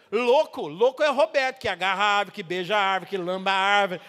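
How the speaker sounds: loud, full-force preaching; preaching shout. Real, not acted. Preacher shouting the punchline to the congregation. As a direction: proclaim it to the whole church.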